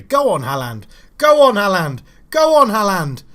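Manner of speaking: building from firm to loud, high shouts; urging on. Real, not acted. Gamer urging his striker through on goal, building to a loud shout. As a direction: cheer the striker on, rising each time.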